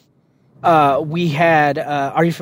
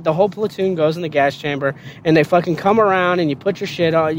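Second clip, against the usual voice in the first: ordinary delivery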